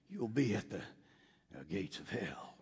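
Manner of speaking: dropping to a whisper on the last words; trailing off to a near whisper; hushed punchline. Acted. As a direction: let it fall away into a breathy hush. Punchline of policeman's directions joke.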